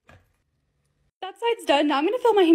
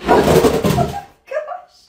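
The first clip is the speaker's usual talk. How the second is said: high breathless laugh through "Oh, gosh"; 5 dB louder than usual. Real, not acted. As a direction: laugh it out, much higher. Laughing at a bucket mishap.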